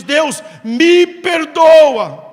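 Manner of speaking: "Deus, me perdoa" very slow and loud; drawn-out plea. Shown as a demonstration. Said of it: "quando você diz".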